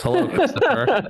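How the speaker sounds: high, sing-song creepy greeting; a lurking online stranger saying hello. Retold or imitated. The stranger messaging young Chris, in a high creepy greeting.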